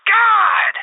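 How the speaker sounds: exasperated furious outburst; angry yelling. Real, not acted. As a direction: snap and yell at both. Moderator loses it, yelling.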